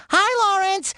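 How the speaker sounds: high-pitched cheery greeting; high, held call; calling out hello. Acted. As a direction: sing out a hello to someone across the set. Calls greeting to off-mic stylist.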